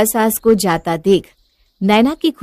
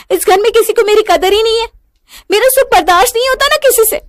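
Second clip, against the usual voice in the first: very high, fast, loud complaint; screaming row; 6 dB louder, faster than usual. Acted. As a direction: shriek your grievance at your husband. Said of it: "चीखने चिल्लाने की आवाज". (चीखते-चिल्लाते हुए शिकायत)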